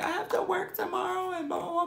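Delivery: very high, teary wail; crying. Retold or imitated. Telling Tank the whole story while crying, high wail. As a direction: whine it through tears.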